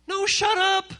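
high-pitched, exasperated cut-off; emphatic shut-down. Acted. Interrupts an acted gossip mid-sentence.

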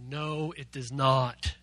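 No, it does not said very slowly and deliberately; slow, firm denial. Shown as a demonstration. Youth pastor leading the group's slow, firm reply. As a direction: draw out every word, firmly. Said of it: "Turn to your neighbor and say".

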